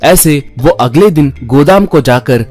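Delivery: usual speaking voice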